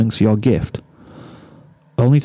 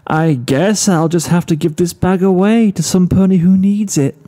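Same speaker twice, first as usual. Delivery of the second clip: overdramatic; 7 semitones higher than usual. A narrator's reading. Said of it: "with an overdramatic sigh and continue".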